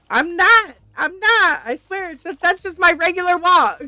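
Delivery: high, loud, comically defensive; mock protest. Husband defending his regular walk to his wife, mock indignant protest. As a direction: imitate an indignant denial, pushed up high.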